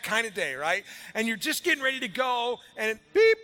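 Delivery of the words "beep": high, loud electronic beep; imitated beep. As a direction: squeal it like a phone alarm. Phone alarm sound imitated.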